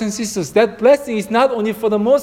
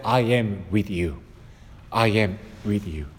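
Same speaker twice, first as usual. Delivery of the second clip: much lower and softer, tender and close; tender promise; 11 semitones lower, 8 dB quieter than usual. Acted. Preacher voicing God's promise to listeners, hushed and tender. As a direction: hush it like a comforting promise.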